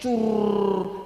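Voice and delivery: long trilled gushing sound; imitated trickling noise. Preacher mimics a grandchild peeing on grandpa, imitated gush.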